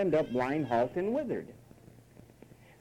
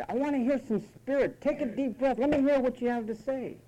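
high-pitched brisk challenge; acted rousing order; mock demanding pep talk; 7 semitones higher than usual. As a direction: demand some spirit from a whiner. Acted demand answering the whiner.

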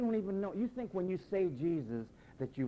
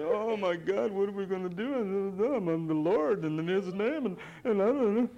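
loud, higher, flustered whining; mock panicked whine; 4 semitones higher, 5 dB louder than usual. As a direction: play the believer falling apart when things change. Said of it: "you whine in the name of the Lord".

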